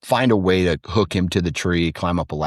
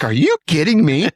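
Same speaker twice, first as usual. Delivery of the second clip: high, loud exasperated dad; can't believe the kid did it; father catching son sneaking out, disbelieving; exasperated disbelief; 10 semitones higher, 5 dB louder than usual. Retold or imitated.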